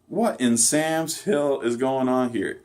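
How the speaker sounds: mock exaggerated Southerner. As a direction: imitate a bad actor overdoing Southern exclamations, slowly. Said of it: "exaggerated Southern accent".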